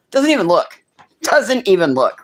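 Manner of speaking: outraged at the clerk; retelling the clerk who ignored her, indignant. Retold or imitated.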